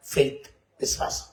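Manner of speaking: whispered emphasis; soft whisper. Real, not acted. Preacher naming the great gift to listeners, whispered. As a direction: hush it as the key word.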